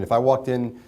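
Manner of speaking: ordinary delivery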